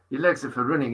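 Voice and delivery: softly imitated Welsh lilt; matter-of-fact imitation of a Welsh friend; mock Welsh accent. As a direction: lightly put on a Welsh accent. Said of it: "Welsh, Welsh he was as well".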